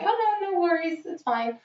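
high-pitched imitated "no worries"; reassuring brush-off. Customer to bartender who bumped into them, high light reassurance. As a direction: lift the voice to wave away an apology.

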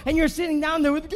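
loud, rising revival preaching; fiery sermon build with a sung lift; preaching at full force. Acted. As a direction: whip the crowd up, ringing out each line. Preacher shouting to the congregation.

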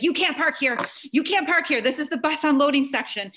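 mock yell of a scolding secretary; retold yelling. Secretary ordering a mom out of the bus section, yelling. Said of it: "The secretary's screaming at me".

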